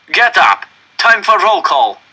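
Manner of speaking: loud, high barked command; a guard yelling at a sick prisoner; shouted order. A narrator's reading.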